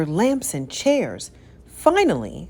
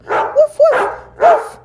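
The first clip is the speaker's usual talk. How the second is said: very high loud dog barks; high frantic woofs; barking alarm; 9 dB louder, slower than usual. A narrator's reading. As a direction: bark the alarm. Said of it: "she cried".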